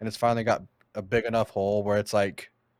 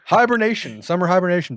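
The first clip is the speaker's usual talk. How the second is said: excited; 7 semitones higher, 6 dB louder than usual. Real, not acted. Joking about sleeping all summer.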